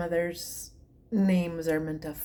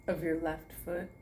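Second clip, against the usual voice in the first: guided meditation; 5 dB quieter than usual. Acted. To her online class, soothing meditation guidance. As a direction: talk them gently from toe to foot. Leads a toe-to-head relaxation.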